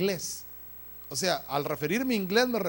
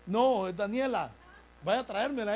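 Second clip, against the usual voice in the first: ordinary talk, nothing marked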